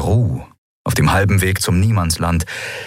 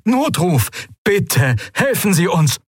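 high, urgent stranger's voice; frightened ship captain calling; desperate distress call; 11 semitones higher, slower than usual. Acted. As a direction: plead for rescue over static.

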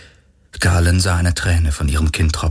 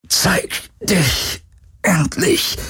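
much higher, slow challenging call; 11 semitones higher, much slower than usual. Acted. Galen provokes the hidden creature, calling out slowly. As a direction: dare the unseen thing to show itself. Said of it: "rief er".

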